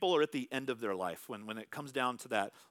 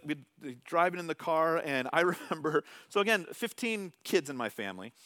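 ordinary delivery

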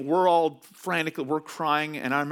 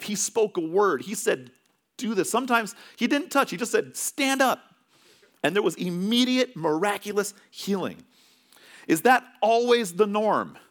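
preaching; 7 semitones higher than usual. Acted. Preaching on healing.

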